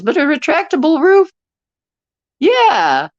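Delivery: light up with a sudden great idea; excited. Real, not acted. Delighted by the roof idea.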